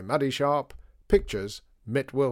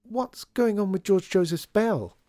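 put-on bright girl's voice; 9 semitones higher, 3 dB louder than usual. Lydia changing subject brightly.

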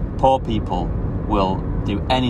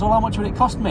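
much higher put-on voice; barber's price query; 13 semitones higher than usual. Barber asking what plastering would cost, high questioning voice. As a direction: imitate him sounding out a price.

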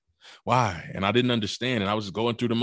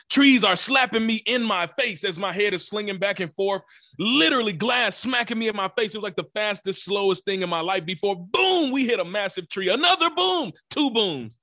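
much higher, punching each boom; vivid impact retelling; 11 semitones higher than usual. Retold or imitated. A man reliving the crash to listeners, booms punched high. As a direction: hit every boom hard and high, like the crash is happening now.

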